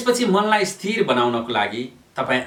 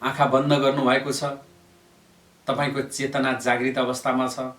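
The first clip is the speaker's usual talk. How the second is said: even, level-pitched yoga nidra voice with long pauses; steady monotone relaxation guidance; guided meditation. Real, not acted. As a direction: lead them calmly into stillness.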